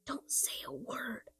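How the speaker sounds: soft urgent whisper. A narrator's reading. Henry hushing Benny as the cart passes, whispering. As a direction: breathe it so nobody on the road hears. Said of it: "whispered Henry".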